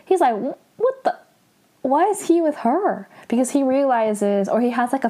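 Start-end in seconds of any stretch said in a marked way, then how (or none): 0.4-1.2 stammered, very high disbelief; mock shock. Sam spots the royal bracelet, stammering in mock shock. 1.8-3.1 high-pitched, incredulous question; mock shock. Sam seeing the bracelet on him, mock shocked.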